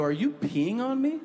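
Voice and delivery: high-pitched incredulous question; retold disbelief. Father discovers son peeing.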